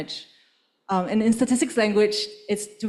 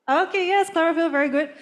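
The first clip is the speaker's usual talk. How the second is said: excited; 8 semitones higher than usual. Real, not acted. Praising an audience answer.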